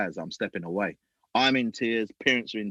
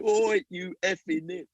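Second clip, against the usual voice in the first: slow, higher mock heckle; mock heckling brother; 8 semitones higher, slower than usual. Imitating the loudmouth brother on the touchline, mock heckle. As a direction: drag out the brother's jeer. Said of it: "still giving it".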